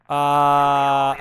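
ordinary delivery

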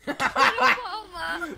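slow drawn-out yell; raging gamer. Acted. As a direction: stretch out the war cry. Slow drawn-out war cry.

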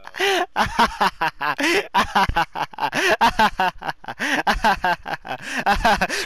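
high cackling laugh; wild laughter. Real, not acted. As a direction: burst out laughing. Wild laughter at friend's clip.

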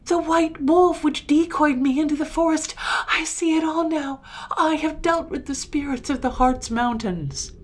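high, loud impassioned outcry; anguished, sustained high exclamation; horrified realization. A narrator's reading. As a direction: cry it out as the truth dawns.

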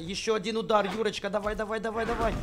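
loud, high, thrilled cheering; excited cheering. Real, not acted. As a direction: cheer on the final hit. Cheering on a winning fight.

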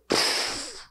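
soft unvoiced puff of air; quiet puffed sound of something vanishing; vanishing pfft. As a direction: blow out a quick dismissive pfft. Imitates fear vanishing.

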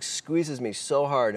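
normal talking voice

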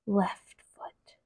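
ordinary delivery, nothing marked

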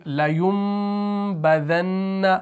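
लयुम with a long hummed m before बदन्ना; very slow, hold the nasals in लयुम बदन्ना; pronunciation demo. Shown as a demonstration. Teacher joins the spelled word for learners, long m in लयुम. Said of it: "इसका तलफ़ुज़ ऐसे होगा".

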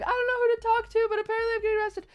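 high-pitched mock wail; imitated crying. Panicked woman telling courthouse security, hammed-up crying voice. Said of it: "like, crying".

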